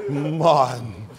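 lightly mimicked wife, drawn-out name; Coretta. Coretta calling her husband, light drawn-out impression. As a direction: let the name hang, low. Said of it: "It's the way she throw Martin's name".

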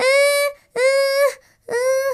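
long, soft crying moans; whining moan. Acted. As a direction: drag out tearful moans of complaint. (কাঁদো কাঁদো কাতরানি)

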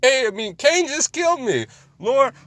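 high-pitched mock plea to God; hammed-up high cry for help; imitating crying out. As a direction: voice jumps way up as Abel calling out. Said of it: "he was crying out".

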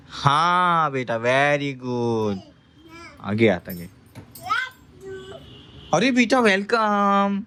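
exaggerated slow sing-song encouragement; mock sweet praise. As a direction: coo it slowly like praising a toddler. Mother coaxing child while feeding.

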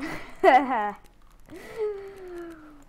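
loud laugh drawn into a high wail; high, trailing laugh. Real, not acted. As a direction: burst out laughing. Laughs at peace treaty.

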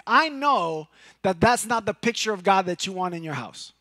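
ordinary talk

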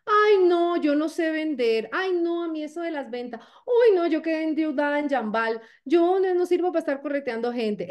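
high-pitched protesting excuses; mock "oh no, not me" objection; reluctant prospect. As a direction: whine reluctantly like a hesitant recruit. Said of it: "Y la persona se queda".

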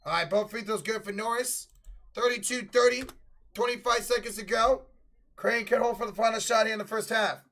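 lively sportscaster calling the game; play-by-play announcer. Acted. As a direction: call it like a live TV broadcast.

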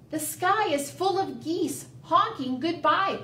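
high, animated little bug voice; crying out. A narrator's reading. Ladybug telling Bear about the geese, crying out. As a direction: pipe up with the news. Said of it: "cried Ladybug".

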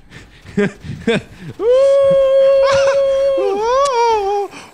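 high, loud shrieking laughter; laughing scream. Real, not acted.

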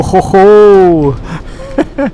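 high loud put-on "ho ho ho"; mock Santa laugh. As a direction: ham up a jolly laugh. Mock voice while playing.

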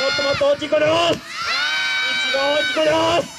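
fast, high shouted おーす, pushing harder; building shout. Acted. Villain pushes the crowd toward their loudest おーす. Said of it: "もっともっと大きい声で".

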